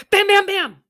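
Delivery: high, loud pounding; loud bangs. A narrator's reading. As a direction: hammer it out. Pounding on the door.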